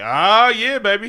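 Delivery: loud, high gloating cheer; hammed-up victorious holler; triumphant return. Hero's triumphant return acted.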